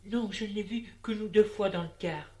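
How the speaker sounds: a touch of panic in his voice; panicking. A narrator's reading. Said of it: "Il panique".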